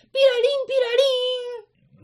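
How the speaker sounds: very high siren beeping; alarm noise imitation. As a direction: mimic a flashing alarm.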